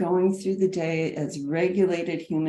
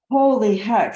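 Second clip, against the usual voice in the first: a sudden, louder burst of exasperation; mock exasperation; 4 semitones higher, 9 dB louder than usual. Real, not acted. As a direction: throw it out like a playful outcry.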